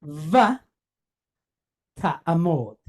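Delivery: Va held alone, pause, then ta'amod; stressed syllables. Shown as a demonstration. Teacher asking if they hear the stress, Va and ta'amod stressed apart. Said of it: "You hear the stress?".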